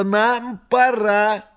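very high, slow cat voice; a cat saying it must go. Acted. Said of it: "сказал кот".

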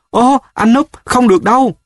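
high-pitched exclamation of protest; crying out. A narrator's reading. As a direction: cry out high in alarmed objection. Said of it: "Ship kêu". (kêu lên phản đối, giọng vút cao)

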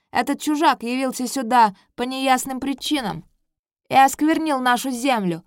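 loud, high accusing prince; haughty prince voice. Acted. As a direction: denounce the stranger loudly.

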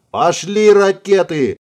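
high, drawn-out yell. Acted. Pilot Черняховский to his commander as missiles launch, yelling. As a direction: yell the alarm, stretching the words out. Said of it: "заорал Черняховский".

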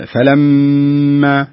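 فلما with the nasal ghunna held long and loud. Shown as a demonstration. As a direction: hold the ghunna in فلما for two counts. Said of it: "بعض الغنن".

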